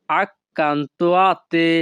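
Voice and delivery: very slow, each sound drawn out; slow demo. Shown as a demonstration. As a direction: stretch it out for the learners. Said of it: "Italian ভাষা".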